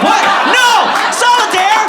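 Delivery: high, loud, disbelieving yell; outraged shout. Acted. Son offered solitaire by his father, outraged shout. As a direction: scream it in disbelief, word by word.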